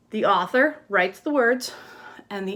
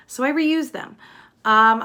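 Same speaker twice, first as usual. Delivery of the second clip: normal talking voice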